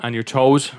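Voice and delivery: ordinary delivery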